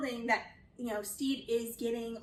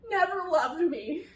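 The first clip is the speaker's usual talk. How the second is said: high, wailing mock sob; mock bursting into tears; 5 semitones higher than usual. Drunk man breaks down to Steve, mock sobbing. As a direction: ham up a man breaking down crying. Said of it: "bursts into tears".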